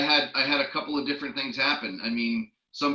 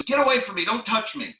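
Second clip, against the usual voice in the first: high, loud retold yell of an angry woman; angry woman snapping at a salesman to back off, loud and high; 8 semitones higher, 4 dB louder than usual. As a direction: shout her off in outrage. Lady yelling at him.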